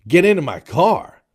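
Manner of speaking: mock shouted order, high and loud; impolite demand. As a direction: bellow it as a rude command. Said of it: "you're not asking politely".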